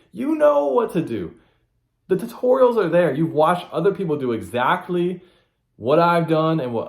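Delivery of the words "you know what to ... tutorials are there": exasperated emphasis. Real, not acted. Hammering the point to listeners.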